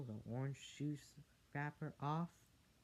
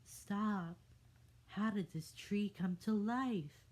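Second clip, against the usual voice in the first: alarmed; 8 semitones higher, 5 dB louder than usual. Acted. Fairy alarmed by tree.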